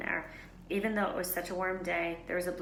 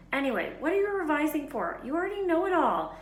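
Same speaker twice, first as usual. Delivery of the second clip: high, loud incredulous question; exasperated boy; 10 semitones higher, 6 dB louder than usual. A narrator's reading. Ron asking Hermione why she revises. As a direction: roll your eyes at her.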